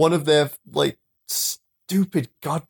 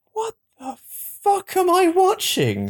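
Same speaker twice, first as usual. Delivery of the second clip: disbelief. Retold or imitated. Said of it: "I'm like".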